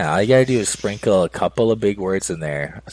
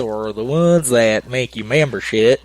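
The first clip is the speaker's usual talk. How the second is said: slow, drawn-out mock southern accent, laughing in; 4 semitones higher, 4 dB louder, slower than usual. Host showing how smart words sound in a southern accent, slow mock southern accent. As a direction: stretch it out in a hammed-up southern accent. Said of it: "you can't say it in, like, a southern accent".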